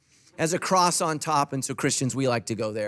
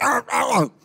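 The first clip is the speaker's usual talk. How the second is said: high, loud imitated growling yelps; mock triggered outcry; 8 semitones higher, 4 dB louder than usual. Pastor imitating triggered conservatives, mock outcry. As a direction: yelp like someone comically triggered. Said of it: "All my conservatives are like".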